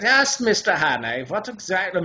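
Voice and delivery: put-on high, sudden stagey German accent. Professor revealed as Nazi spy mid-monologue, German accent. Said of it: "I had to switch accents halfway through".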